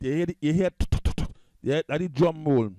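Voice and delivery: normal talking voice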